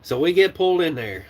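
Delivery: normal talking voice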